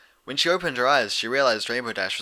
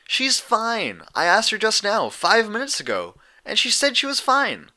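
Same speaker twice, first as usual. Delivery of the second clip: high, louder tomboy pony voice; impatient pegasus insisting her friend is fine; exasperated Rainbow Dash; 12 semitones higher, 5 dB louder than usual. A narrator's reading. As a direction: brush the worry off, higher and louder.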